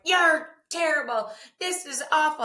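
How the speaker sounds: put-on high, loud teasing kid voice; mock kids' verdict. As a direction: play the twins, gleefully brutal. Kids voiced giving blunt feedback.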